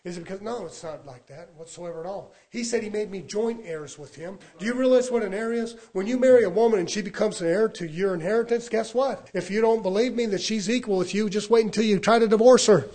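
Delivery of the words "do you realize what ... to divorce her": higher, building preacher delivery; forceful preaching. Real, not acted. Preacher explaining an heir to his congregation, forcefully. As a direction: drive the point home, building in volume.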